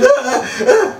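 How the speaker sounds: very high wailing sobs; hammed-up crying noises; mock sobbing. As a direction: imitate hysterical bawling. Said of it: "Her tears are like this".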